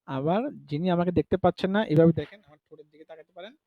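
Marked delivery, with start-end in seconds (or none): none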